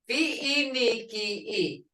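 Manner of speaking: Fi-ni-ki-i stretched slowly, syllable by syllable. Shown as a demonstration. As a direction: spell out Fi-ni-ki-i slowly. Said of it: "You can't hear that ni very good".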